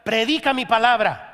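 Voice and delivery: booming divine command. Acted. Preacher voicing God's call to preach, loud and commanding.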